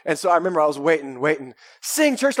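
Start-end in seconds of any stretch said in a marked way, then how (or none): 1.8-2.4 loud, high imitated cue; worship leader's call. Worship leader cueing a waiting boy, shouted. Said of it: "he'd always go".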